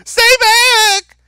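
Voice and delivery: shrieking high mock yell of stay back; hysterical woman. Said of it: "you sound like a hysterical woman".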